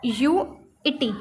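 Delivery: एनयूईटी spelled out very slowly letter by letter; drawn-out spelling of एनयूईटी. Shown as a demonstration. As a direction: stretch एनयूईटी slowly for the class. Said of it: "NUT".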